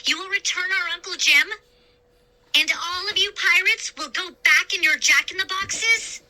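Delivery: high, fast pressing questions; eager demand. A narrator's reading. Boy demanding the pirate keep his promise, high and fast. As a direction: rush to pin him to his promise. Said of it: "I demanded".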